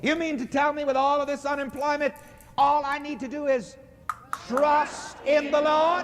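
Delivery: high, fast incredulous preacher question; mock disbelief. Preacher voicing the doubters' question to the congregation, high and mock-incredulous.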